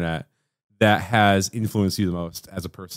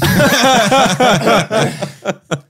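high burst of laughter; loud laugh; 13 semitones higher, 6 dB louder than usual. Real, not acted. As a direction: crack up loudly. Big laugh at porn joke.

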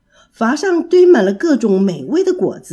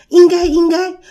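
high, loud eager cry of assent; eager agreement; 7 semitones higher, 6 dB louder than usual. Acted. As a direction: answer the king eagerly, all at once. Monkeys answer in unison. (众猴齐声热切高喊)

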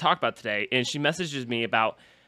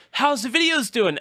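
high friend question; 12 semitones higher than usual. Retold or imitated.

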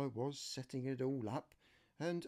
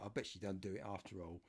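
usual speaking voice

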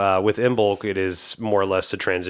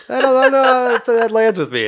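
mock doubtful voice; 9 dB louder than usual. Joking aside, pitched high and loud.

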